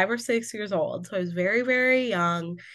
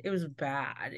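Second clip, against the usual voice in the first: much softer, sinking repeat; quiet echo; 5 semitones lower, 9 dB quieter than usual. Real, not acted. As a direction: drop it to a low murmur. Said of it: "repeats it quietly".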